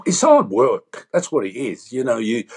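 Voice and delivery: ordinary talk, nothing marked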